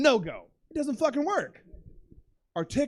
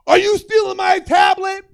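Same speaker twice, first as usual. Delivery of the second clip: high, loud accusing shriek, drawn out; screaming; 10 semitones higher, 13 dB louder than usual. Retold or imitated. Uber driver accusing passenger over iPad, screaming. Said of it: "The Uber driver starts screaming".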